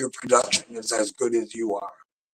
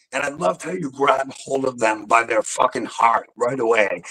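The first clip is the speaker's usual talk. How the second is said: ordinary talk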